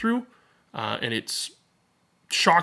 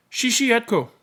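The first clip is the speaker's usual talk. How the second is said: lightly voiced excited boy calling out, higher; eager little boy; 10 semitones higher, 4 dB louder than usual. A narrator's reading. Boy impatient on the truck ride home, calling his sister eagerly. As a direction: call her name like an impatient kid.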